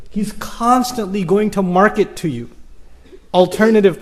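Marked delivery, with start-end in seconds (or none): none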